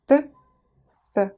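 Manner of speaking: т and с pulled apart, unhurried; slow separate т and с. Shown as a demonstration. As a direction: say Т then с slowly, apart. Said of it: "Let's pronounce them slowly".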